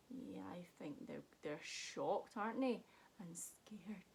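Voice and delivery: soft whispered suspense; quiet conspiratorial whisper. Pretend. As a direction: keep it hushed for the children. Hushed after the sneeze.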